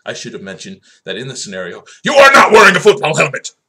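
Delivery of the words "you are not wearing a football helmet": sudden high, full-force yell; outraged shout. Acted. Host scolding the viewer in the cinder block scenario, shouting. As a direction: explode with alarm at the fatal mistake. Climactic mock-outraged reveal.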